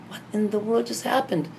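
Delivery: high, startled cry of disbelief; frightened disbelief. Retold or imitated. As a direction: gasp it out, spooked by what you just saw. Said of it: "I'm like".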